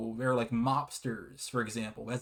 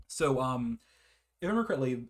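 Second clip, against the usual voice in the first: usual speaking voice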